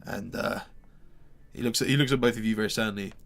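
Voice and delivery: ordinary delivery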